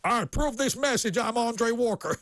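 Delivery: cheerful US candidate sign-off; chirpy politician approval line; American accent. Acted. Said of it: "then they do the".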